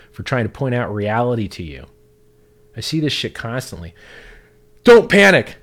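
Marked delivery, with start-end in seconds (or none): 4.8-5.6 high, loud mocking "Don't panic"; mocking shout. Ranting streamer mocking online critics with a loud, high taunt. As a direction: throw their words back as a loud taunt. Mocking people who call others fearful.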